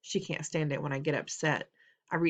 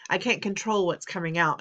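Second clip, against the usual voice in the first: ordinary delivery, nothing marked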